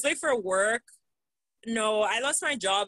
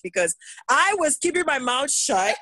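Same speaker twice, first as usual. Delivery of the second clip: ordinary talk, nothing marked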